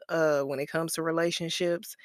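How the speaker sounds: ordinary talk, nothing marked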